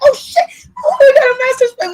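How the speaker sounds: high, loud, fast mock fright at a rat; mock panic. Homeowner hearing noises in the roof, mock panicked yelp. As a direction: yelp it like you just spotted a giant rat. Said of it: "you be like".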